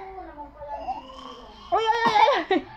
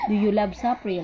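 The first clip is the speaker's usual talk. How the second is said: usual speaking voice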